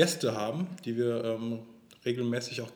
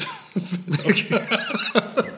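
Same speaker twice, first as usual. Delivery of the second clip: long breathy giggle; a hearty breathless laugh; airy laugh; 8 semitones higher, 6 dB louder than usual. Real, not acted. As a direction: burst out laughing at the joke. Laughing at joke.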